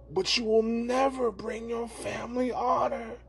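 high, drawn-out matchmaker tirade; hammed-up shrieking rebuke; mock scolding. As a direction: declaim it like an outraged matchmaker. Acts matchmaker's scolding in story.

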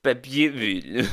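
slow, loud French imitation; mock French accent. Shown as a demonstration. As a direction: echo the French word slowly, hammed up. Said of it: "kako se kaže na francuskom".

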